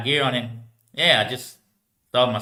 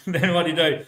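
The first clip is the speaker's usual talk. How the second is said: laughing; 5 semitones higher than usual. Real, not acted. Laughing while retelling.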